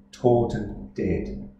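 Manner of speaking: usual speaking voice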